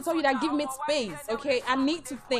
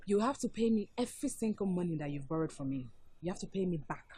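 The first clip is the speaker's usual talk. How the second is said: low, very soft, controlled demand; hushed and firm; quiet ultimatum; 7 semitones lower, 15 dB quieter than usual. Acted. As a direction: state your terms calmly, barely raised voice. Girlfriend states her condition.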